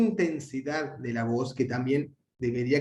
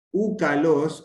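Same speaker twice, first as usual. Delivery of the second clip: úcalos as one word, leaning onto the final los; proclitic demonstration; 2 semitones higher, 5 dB louder, much slower than usual. Shown as a demonstration. Teacher models a proclitic, úcalos joined to the following word. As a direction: run úcalos together, accent at the end. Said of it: "se pronuncian apoyadas en el acento".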